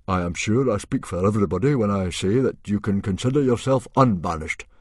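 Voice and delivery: loud, formal chieftain's address; awkward chief. Acted. Stoic asks his banished son to face the monster, formally awkward. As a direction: make an awkward official request. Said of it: "Stoic gave an awkward cough".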